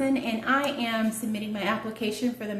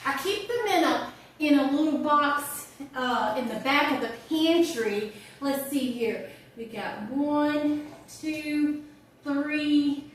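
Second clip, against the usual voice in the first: put-on animated older friend, higher than the introduction; chatty monologue character; 5 semitones higher, slower than usual. Friend offering a homemade birthday poem, chatty and teasing. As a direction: play a chatty, teasing birthday well-wisher.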